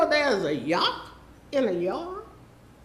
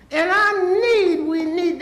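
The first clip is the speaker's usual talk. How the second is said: I need, drawn out slow, higher and louder; emphatic plea; 7 semitones higher, 8 dB louder, slower than usual. Real, not acted. A resident pleading with the council for the hospital, loud and slow. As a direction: lean hard on need, pleading.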